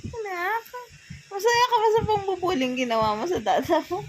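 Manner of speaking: put-on baby talk.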